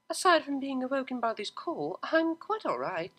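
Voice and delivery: drowsy dry politeness; Octavia. Acted. Octavia voiced.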